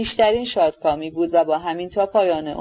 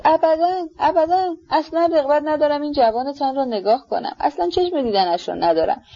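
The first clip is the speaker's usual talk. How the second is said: high, heated, fierce denial; heated outburst; 7 semitones higher than usual. A narrator's reading. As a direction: flare up and refuse outright. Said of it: "جوشان گفت". (انکار تند و پرشور با صدای زیر)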